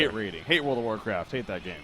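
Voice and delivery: flat, low, mock-serious voice; deadpan. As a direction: say it dead straight, trying not to laugh. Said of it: "I can't keep a straight face while saying that".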